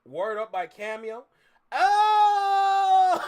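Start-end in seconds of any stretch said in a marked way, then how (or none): 1.7-3.2 a sudden, much higher and louder shout of surprise; shocked oh. Real, not acted. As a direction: blurt it out, caught off guard. Caught by surprise live.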